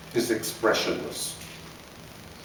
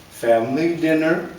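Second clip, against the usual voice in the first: plain reading voice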